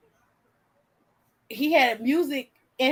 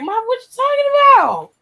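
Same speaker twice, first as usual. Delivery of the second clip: much higher, much louder disbelieving cry; can't believe what mom just said; shocked disbelief; 13 semitones higher, 9 dB louder than usual. Retold or imitated. Daughter asking her mom about the soloist, shocked and loud.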